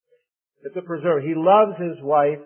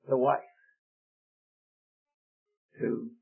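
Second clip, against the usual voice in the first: ordinary talk, nothing marked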